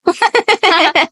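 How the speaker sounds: high-pitched burst of laughter; laughing. Real, not acted. Hosts cracking up over a failed twinsy, real laughing. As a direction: crack up at the silly mishap.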